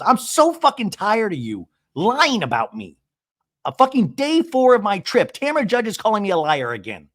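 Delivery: high, exasperated mock rant; imitated high-pitched exasperation; fed-up outburst. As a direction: act out being sick of it. Retold fed-up outburst at dinner.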